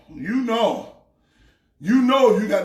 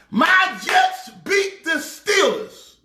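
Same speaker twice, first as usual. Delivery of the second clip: triumphant; 6 semitones higher, slower than usual. Real, not acted. Triumphant boast about Jets.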